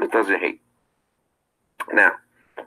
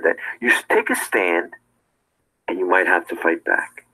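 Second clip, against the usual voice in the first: normal talking voice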